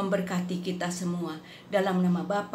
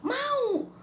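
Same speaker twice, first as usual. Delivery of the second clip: much higher, thin voice of a sick man; frail man's answer. Retold or imitated. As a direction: answer like a weak dying man. Dying friend answers her.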